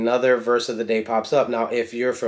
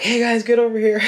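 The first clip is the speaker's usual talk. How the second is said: put-on high, fast call to the kids, a bit louder; sudden dad voice summoning the children; 11 semitones higher, 4 dB louder, faster than usual. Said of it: "my dad senses kicked in, and I'm like".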